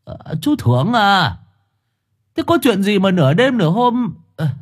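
put-on high-pitched old man's questioning; puzzled elderly man woken late at night; man of seventy. A narrator's reading. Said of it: "Một người đàn ông độ bảy chục".

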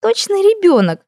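very high, drawn-out merry exclamation; gleeful princess teasing; amused. A narrator's reading. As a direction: tease delightedly in a high voice. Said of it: "снова засмеялась принцесса".

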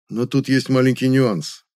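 ordinary delivery, nothing marked

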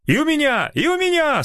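high happy crowd cries; joyful shouts. Acted. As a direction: call out gleefully. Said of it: "радостные возгласы". (радостные возгласы)

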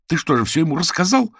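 indignant. A narrator's reading. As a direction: blurt it out in indignation, pitch leaping up. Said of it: "От возмущения девушка даже перестала шептать".

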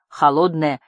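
plain reading voice

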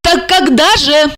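high, nagging question; insistent female character voice; stubborn insistence. A narrator's reading. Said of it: "упрямо повторила Регина свой вопрос".

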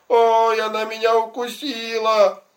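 high, loud cry of pain; boy's shout. Acted. Little boy yelling to everyone in the park, loud high cry. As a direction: yell it out as the bitten little boy. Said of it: "Папа кричал".

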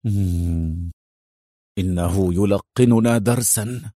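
low, bitter male voice. A narrator's reading. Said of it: "قائلًا في مرارة".

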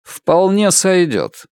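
cool, slow acceptance; measured, unimpressed verdict; cold. A narrator's reading. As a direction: accept it coldly, taking your time. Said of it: "холодно сказал Ричард".

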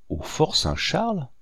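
high-pitched, incredulous echo; startled and appalled; shocked question. A narrator's reading. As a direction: repeat it in dismayed disbelief, voice leaping up. Listeners hear the dreadful news.

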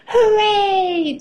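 put-on high, drawn-out child's cheer; gleeful cheer. A narrator's reading. Peppa cheers for her giant teddy, high and gleeful. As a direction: cheer high and let it fall. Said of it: "cheers Peppa".

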